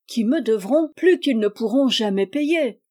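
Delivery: ordinary delivery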